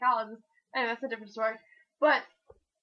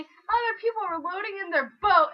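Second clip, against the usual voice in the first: normal talking voice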